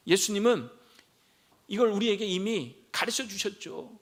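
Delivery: ordinary talk, nothing marked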